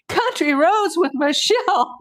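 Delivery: high, loud laughing cry; laugh-cry the name out. Real, not acted.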